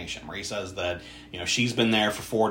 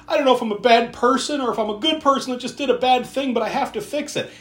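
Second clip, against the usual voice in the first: anxious confession; 12 semitones higher than usual. Retold or imitated. Andre confesses he did bad.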